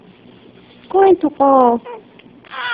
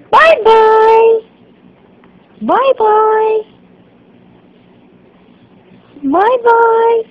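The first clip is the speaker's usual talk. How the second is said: singsong bye-bye; 8 semitones higher, 3 dB louder, much slower than usual. Real, not acted. Waving bye to baby.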